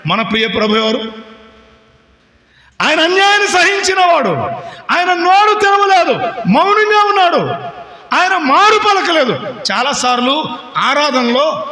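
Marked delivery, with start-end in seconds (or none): 2.8-9.5 high ringing proclamation, slowing on the last lines; full force preaching. Acted. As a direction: preach it out to the congregation with fervour. Preaching climax about the Lord. (పూర్తి ఉత్సాహంతో ప్రసంగం)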